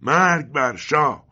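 slow, high full-force protest cry; thunderous shout. A narrator's reading. Father defies the agents with a slogan, huge drawn-out shout. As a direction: roar the slogan out slowly. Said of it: "پدرم چنان فریاد کشید".

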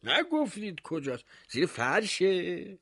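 high, doubting mayor; mayor's voice. Acted. Mayor doubting the baker's tale, high and sceptical. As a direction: pitch it up with sceptical disbelief.

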